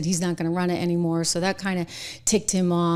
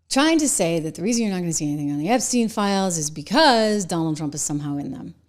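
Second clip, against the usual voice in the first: usual speaking voice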